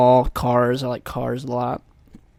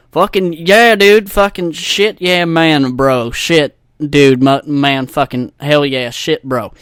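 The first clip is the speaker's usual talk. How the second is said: loud, hammed-up country accent; put-on country radio accent; 2 semitones higher, 9 dB louder, slower than usual. Podcaster mocking country radio hosts, exaggerated country accent. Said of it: "You don't talk with that accent".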